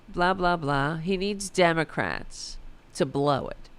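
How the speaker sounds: ordinary talk, nothing marked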